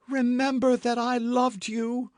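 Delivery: high, loud pleading cry. A narrator's reading. Milady crying out to D'Artagnan for mercy. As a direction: beg him with everything left. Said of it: "cried she".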